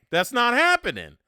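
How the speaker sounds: loud, flat-out no; emphatic refusal. Real, not acted. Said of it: "Eh, eh".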